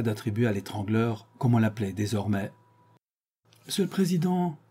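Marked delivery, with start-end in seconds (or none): 3.7-4.6 high pitched cry of address; exclaimed. A narrator's reading. Séraphin crying out to the court president, high. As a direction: cry out to the judge, much higher. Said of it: "s'écria Séraphin".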